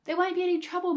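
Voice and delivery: high, loud, dismissive outburst; overexcited father; excited cry. A narrator's reading. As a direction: wave the worry away excitedly. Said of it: "cried Mister Cranky".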